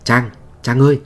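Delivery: put-on calling a missing girl's name. Calling out for Trang in dark.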